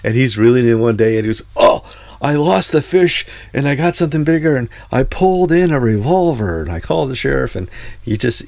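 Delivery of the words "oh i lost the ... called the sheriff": excited Florida fisherman, higher voice; Florida accent. Retold or imitated. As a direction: imitate a Florida man telling his fish tale, pitched up. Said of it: "doing this in this great Florida accent".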